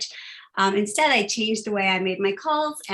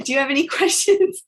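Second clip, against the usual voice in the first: real laughter breaking into a louder, higher question; laughing; 6 semitones higher, 5 dB louder than usual. Real, not acted. Presenter apologising for rushing, asks for questions while laughing. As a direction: laugh your way through the question.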